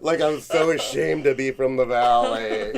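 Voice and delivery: joking shame, laughing. Real, not acted. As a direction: laugh through mock shame. Laughing self-mockery.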